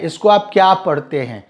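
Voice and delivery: ordinary talk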